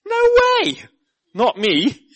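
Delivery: high, loud protest as Peter; indignant Peter. Acted. Peter protesting to Jesus, high and loud. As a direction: shoot it down in disbelief.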